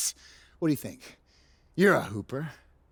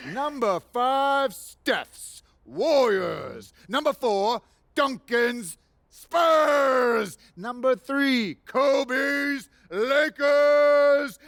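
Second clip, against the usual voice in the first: milking the applause; 4 semitones higher than usual. Acted. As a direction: stretch every name like a grand reveal. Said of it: "please hold your applause until the end".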